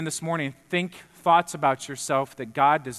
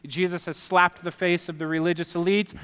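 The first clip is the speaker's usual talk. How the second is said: usual speaking voice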